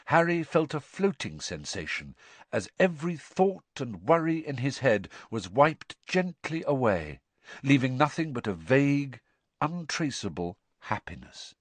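usual speaking voice

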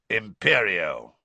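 loud, drawn-out spell casting; incantation. A narrator's reading. Teacher casting a curse on his student, loud incantation. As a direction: cast it with force, stretching the word.

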